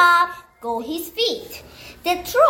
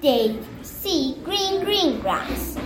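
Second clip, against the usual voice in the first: ordinary delivery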